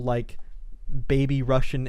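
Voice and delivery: normal talking voice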